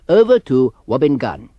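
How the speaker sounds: normal talking voice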